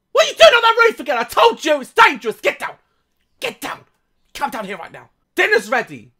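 high, loud telling-off mum voice; scolding mum. Pretend. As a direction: nag him like a worried mother.

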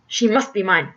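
sinister villain's stern demand; stern snap. Acted. Sinister squire demands the daughter, sternly snapping. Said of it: "sternly snapped the s-, sinister squire".

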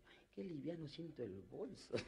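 put-on soft, slow old lady sighing at her light purse; a lightly voiced old woman, puzzled. Old lady noticing her purse feels light, soft and slow. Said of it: "La vieja dice".

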